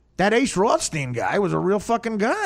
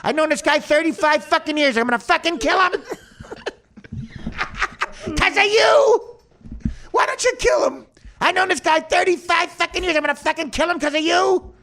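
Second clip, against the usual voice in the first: Joe Pesci impression; 9 semitones higher than usual. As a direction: rant like an angry Pesci mobster. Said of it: "I didn't know you had the Pesci in you".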